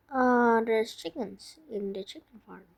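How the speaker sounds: ordinary delivery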